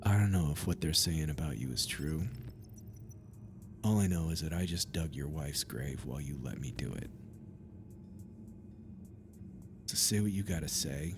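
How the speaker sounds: low, soft, grim voice; a bitter gravedigger confronting the priest; grim challenge. Acted.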